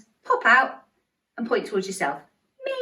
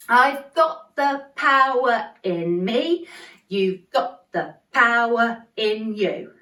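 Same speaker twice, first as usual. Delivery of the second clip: slow, got and power punched; stress on got and power; much slower than usual. Shown as a demonstration. Teacher leading the chorus, slow stressed got and power. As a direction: lean on got and power slowly. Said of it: "The emphasis is on got and power".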